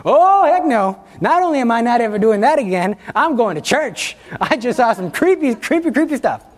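high, loud mock panic; hammed-up scared refusal, voice jumping up; comic freak-out. Scared person swearing off Ouija boards, comic panic.

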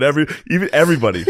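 ordinary talk, nothing marked

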